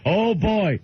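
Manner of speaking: high, drawn-out simple-man voice; Bill. Retold or imitated. Bill carrying on eating regardless, high caricature. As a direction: caricature a simple old man's delight.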